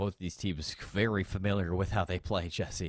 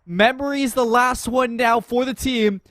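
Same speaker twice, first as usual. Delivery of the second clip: rising play-by-play call; esports caster; 8 semitones higher, 6 dB louder than usual. Acted. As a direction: call the action as it builds.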